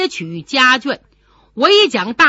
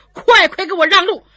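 angry demand; 8 semitones higher, 6 dB louder, faster than usual. Acted. General demands passage.

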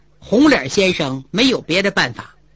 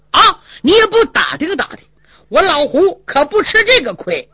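high, loud indignant flare-up; angry retort; 6 semitones higher, 4 dB louder than usual. Acted. Hu Dahai flaring up at the red-faced man, angry and high. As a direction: bristle and threaten back.

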